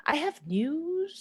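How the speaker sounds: slow, drawn-out teasing lilt; teasing singsong. Acted. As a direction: tempt her with the secret. Lucy tries yet again.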